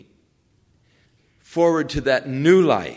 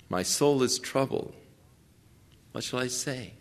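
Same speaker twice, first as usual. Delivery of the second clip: quiet, understated troubled prayer; inner turmoil; 7 dB quieter than usual. Retold or imitated. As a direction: voice a troubled soul softly, trailing down. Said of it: "go through this inner turmoil".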